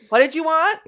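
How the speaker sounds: loud, high mock-annoyed assistant; testy retort. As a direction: answer back as a fed-up voice assistant. Acts out Alexa answering back.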